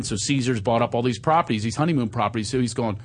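usual speaking voice

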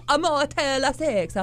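slow, high fake Italian; exaggerated Italian singsong; mock Italian accent. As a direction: stretch the vowels like a stage Italian. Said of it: "Anything's Italian if you say it right".

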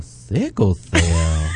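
a timid little voice saying it; lightly imitated small feminine voice. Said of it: "little feminine voice".

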